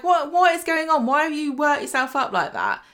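high, incredulous retold questioning; imitating exasperated disbelief; mock bafflement. Acting Lawrence baffled by the panic.

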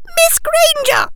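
tiny, very high, loud squeaky voice; high-pitched squeaky professor; alarmed squeak. A narrator's reading. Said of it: "squeaked Professor Flitwick".

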